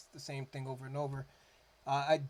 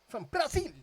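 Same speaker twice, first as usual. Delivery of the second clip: much higher, louder hammed-up cry of from Brazil; Brazil shout; 13 semitones higher, 8 dB louder than usual. Naming the song's origin, a hammed-up Brazil cheer. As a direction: belt it out like a cheering fan. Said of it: "I always do that anytime".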